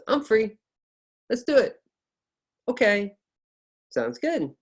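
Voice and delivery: "I'm free", "Let's do it" pitched high; upbeat short statements. Shown as a demonstration. Teacher modelling short statements, high intonation. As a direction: pop "Okay" up high and short. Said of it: "Or short statements".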